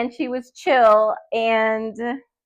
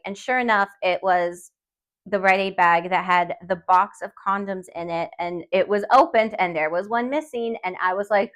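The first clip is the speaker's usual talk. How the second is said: ordinary delivery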